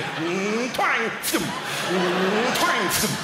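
effortful hum, then a high twang and thump; straining tug noises. Acted. Hotel guest tugging at the duvet, comic straining noises. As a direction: imitate heaving at something that springs back.

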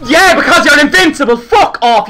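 loud furious snarl; raging gamer. Real, not acted. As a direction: shout at the invincible enemy. Raging at enemy player.

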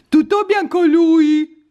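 high, loud, slow coo at the cat. Real, not acted. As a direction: squeal it to the cat. Higher louder repeat. (vocina acuta e squillante)

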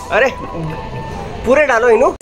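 ordinary delivery

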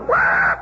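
deep, loud groggy yelp; a low bellow of a man yanked from sleep; startled yell. Retold or imitated. Half-asleep soldier startled awake, loud low yell. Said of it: "You go".

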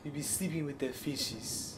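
put-on slow, soft Italian mobster accent that drifts African; badly faked Italian accent, slow and quiet; botched Italian accent. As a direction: try an Italian accent and miss. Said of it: "I don't know why I went African accent right there".

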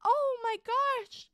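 high, loud and slow, drawn-out awe; overwhelmed gasp. Real, not acted. Streamer touched by viewer gifts, awed and loud. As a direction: let the thanks overwhelm you.

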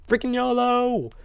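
high, loud, slow Freaking yolo!; yelled battle cry. Real, not acted. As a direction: roar Freaking yolo! as you leap. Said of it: "You guys ready for a freaking yolo?".

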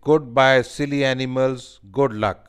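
loud lion goodbye; farewell from lion. A narrator's reading. Lion sees off the silly animals, loud farewell. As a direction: send them off loudly as the lion. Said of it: "The lion says".

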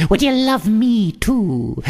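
sudden very high witchy taunt; shrill hag squeak. A narrator's reading. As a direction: leap way up like a cackling witch.

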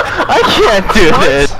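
high, slow, laughing wail; mock-distraught through a laugh; comic despair. Driver too guilty to keep driving, in laughing despair.

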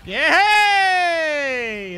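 loud, drawn-out victory yell; triumphant cheer. Real, not acted. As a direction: cheer it out at full voice. Boss defeated, celebrating.

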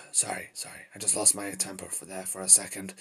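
hushed, sheepish apology; subdued apology. Acted. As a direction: back down quietly after losing your temper. Apologising after outburst.

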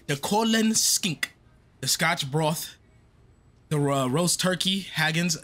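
slow, put-on Irish accent reading out dish names; mock Irish accent. Streamer reading the game's menu aloud in a mock Irish accent. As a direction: try a playful Irish accent on each food, drawn out. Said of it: "they got me trying to talk like Irish".

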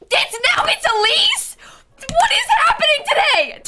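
very high shriek of disbelief; squealing, incredulous shout; shocked outburst. Real, not acted. Same villager turns up again.

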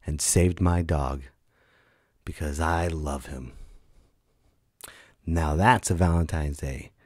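normal talking voice

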